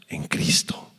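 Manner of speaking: slow hushed whisper. Acted. Preacher telling the church what matters most, whispered. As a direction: lean in and whisper it.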